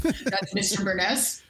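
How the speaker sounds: laughing through the name; amused laugh. Real, not acted.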